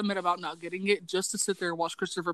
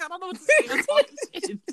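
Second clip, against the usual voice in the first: very high, laughing through the words; laughing confession; 12 semitones higher than usual. Retold or imitated. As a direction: giggle out the admission. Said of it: "I was like".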